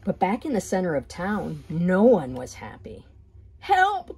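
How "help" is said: high, loud trapped cry; cry for help. Acted. Flounder crying for help from beneath the trap. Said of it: "cried a voice".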